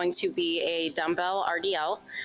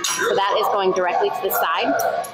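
raised voice over gym noise; loud over noise; 2 semitones higher, 5 dB louder than usual. Real, not acted. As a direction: project over the music. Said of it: "so much noise right now".

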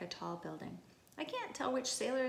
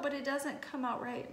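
plain reading voice

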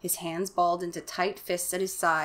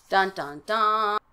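dramatic sting; much slower than usual. Pretend. Host mocking a cliffhanger, dun dun dun spoken as a playful sting. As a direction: hum "Dun, dun, dun" like an ominous chord. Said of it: "And so now we".

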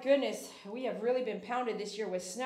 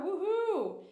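high, loud woohoo; celebratory whoop; cheer; 8 semitones higher, 7 dB louder than usual. Real, not acted. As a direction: whoop it up for the member. Cheering a member on stream.

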